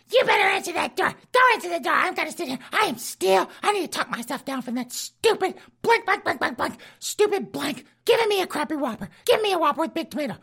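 high squeaky granny barking orders; bossy falsetto wife. Retold or imitated. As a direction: boss him around in a shrill falsetto. Wife bossing husband at home.

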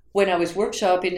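ordinary talk

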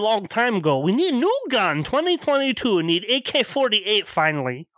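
offended mock Russian accent; Eastern European accent. Game master answering the mockery in a Russian accent. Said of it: "Terrible Eastern European cliches".

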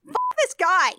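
high-pitched exasperated curse; indignant outburst. Acted. Disgusted reaction, building on repeats.

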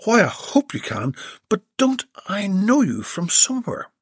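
a puzzled society lady; Belinda's voice. Acted.

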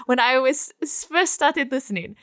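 hammed-up German-accented letter reading; cartoonish German accent, now and then amused; obnoxious German accent. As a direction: keep up a fake German accent to the sign-off. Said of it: "most obnoxious German accent possible".

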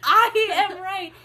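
high, loud, slow indignant protest. Real, not acted. Arguing with friend about sherbet, laughing indignant protest. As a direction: drag it out in mock outrage.

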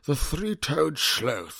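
slow, high nature documentary narration; David Attenborough impression. Shown as a demonstration. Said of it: "the key to doing a good accent".

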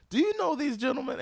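high loud mocking question; mock official. Insurance man showing grandmother footage, high mock question. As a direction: ham up the insurance man's gotcha.

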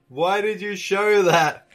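ordinary delivery

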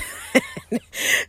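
high burst of laughter; a sudden real laugh. Real, not acted. Laughs at uncertain house sale.

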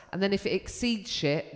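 ordinary delivery

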